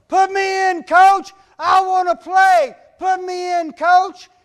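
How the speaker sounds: high, loud shouted plea; hollering to the coach. Retold or imitated. Boy on the sideline hollering at coach, loud and high. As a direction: yell from the sideline to get in. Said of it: "I hollered".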